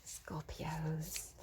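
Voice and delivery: soft unvoiced whisper. Real, not acted. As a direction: breathe the word out. Whispered aside.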